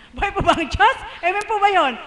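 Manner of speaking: rally the crowd with it; fervent shout of praise; loud call to the congregation. Real, not acted. Testimony calling out to congregation.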